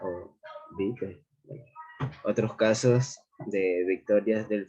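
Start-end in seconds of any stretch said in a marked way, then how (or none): 0.8-1.2 "vitae" said as two syllables, "BEE-te"; alternative Latin pronunciation. Shown as a demonstration. Student offering classmates another pronunciation, "vitae" as "BEE-te". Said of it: "que se pronuncia".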